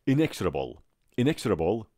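"Inexorable" as "in-EKS-ra-bol", crisp ks; correct model pronunciation. Shown as a demonstration. Teacher correcting after the wrong forms, "Inexorable" with ks. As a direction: punch EKS in "Inexorable" and swallow the middle vowel. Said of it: "پشت سر من بگید".

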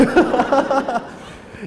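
high-pitched burst of laughing; laughter. Real, not acted. Host asking a volunteer to hold the mic, high laughter. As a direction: laugh high at your own request.

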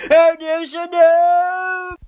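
acted panic.

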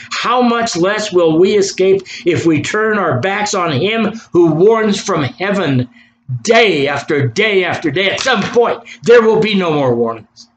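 high, forceful pulpit warning; fervent preacher's cry of judgment; urgent warning. Real, not acted. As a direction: stretch the key words, building in urgency.

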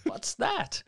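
very high squeaky mock query; gang member asking. A biker noticing smoke, high mock question. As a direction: pipe up like a puzzled thug. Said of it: "one of them goes".

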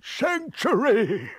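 high, drawn-out plea; imploring. Acted. Wild-eyed man begging the aunt for refuge, imploring. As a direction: beg in a high, slow wail. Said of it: "he implored".

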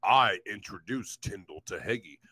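mock him in a dumb low drone; low sneering impression of the quoted man; mocking quote. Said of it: "I'm gonna fucking quote him".

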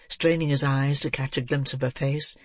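ordinary delivery, nothing marked

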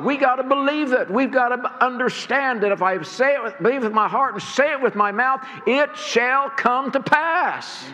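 building sermon climax; preaching at full force. Acted. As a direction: drive it home to the congregation.

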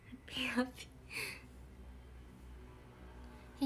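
ハッピーハッピー whispered very slowly and softly. Real, not acted. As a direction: stretch ハッピーハッピー out in a hush. Repeats the greeting as whisper. (ゆっくりささやくハッピーハッピー)

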